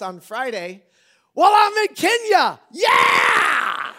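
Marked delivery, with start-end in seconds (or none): 1.3-3.9 gleeful shout. Real, not acted. Pastor shouting to the church that he'll be in Kenya, gleeful. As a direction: shout it high and loud in glee. Gleeful escape from moving help.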